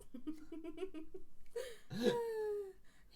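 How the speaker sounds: loud, high-pitched laugh; long laugh climbing into a loud squeal. Real, not acted. Co-host reacts to remark about coils, high loud laugh.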